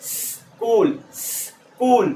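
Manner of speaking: "स्कूल" with a long hiss, then "kool"; long practice s. Shown as a demonstration. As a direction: drill "स्कूल" slowly, stretching the s. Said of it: "practice ऐसे ही करना है". ("स्कूल" में लंबा खिंचा स)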